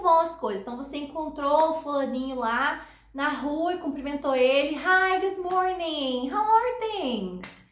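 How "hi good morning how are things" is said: acted street greeting. Greeting someone met in the street.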